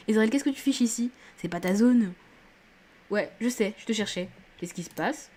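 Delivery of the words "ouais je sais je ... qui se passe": ordinary delivery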